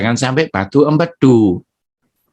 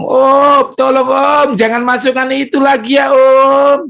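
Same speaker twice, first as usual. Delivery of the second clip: high, loud begging little voice; pleading stomach; 11 semitones higher, 3 dB louder than usual. Pretend. Stomach begs its owner to stop the bad food, pleading. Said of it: "Dia ngomong".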